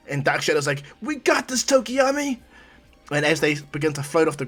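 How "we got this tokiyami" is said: very high put-on creature voice; eager shadow creature. Dark Shadow reassuring straining Tokiyami, high and eager. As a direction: chirp it high, full of confidence.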